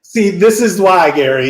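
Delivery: ordinary talk, nothing marked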